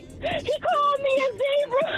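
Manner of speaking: put-on high, drawn-out childish tattling wail; mock crying wail. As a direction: wail it like a little kid telling on someone. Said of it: "when I was crying".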